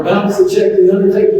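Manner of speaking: a retold undertaker's indignant jab; mock offended retort. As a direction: throw the joke back at him, offended. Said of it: "and he said".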